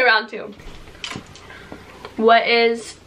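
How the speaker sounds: usual speaking voice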